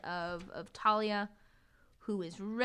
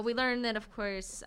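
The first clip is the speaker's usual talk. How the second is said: ordinary talk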